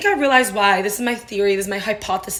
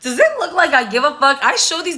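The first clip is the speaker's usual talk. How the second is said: defiant; 7 semitones higher, 5 dB louder than usual. Real, not acted. Defiant retort.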